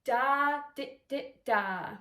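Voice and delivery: long DA, two light short DIs, long DA; one-note articulation demo. Shown as a demonstration. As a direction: chant "Da, di, di, da" as long and detached notes. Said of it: "Just choose one note".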